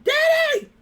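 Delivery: very high, loud cry for Dad; full yell. Retold or imitated. Daughter who can't get up calls her father, full high yell. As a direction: scream across the house for your father. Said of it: "I'm yelling".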